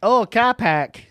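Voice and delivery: slow Boston-style "car park" as "cah pahk"; mock Boston accent. Co-host echoing the phrase in a slow mock Boston accent. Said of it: "So it's from Boston or England".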